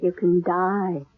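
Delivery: slow, low, deadly calm threat. Acted. Killer threatening the psychic she came to silence, slow and chilling. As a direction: savour each word of the threat. Killer reveals herself to Emma.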